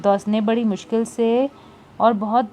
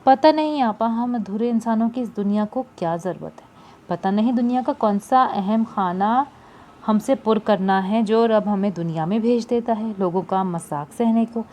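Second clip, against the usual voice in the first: usual speaking voice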